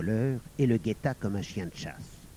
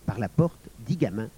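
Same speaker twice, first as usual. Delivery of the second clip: usual speaking voice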